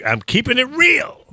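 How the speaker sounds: high, sing-song "real"; playful catchphrase. Real, not acted. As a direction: toss it off like a slogan.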